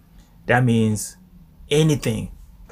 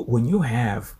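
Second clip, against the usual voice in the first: usual speaking voice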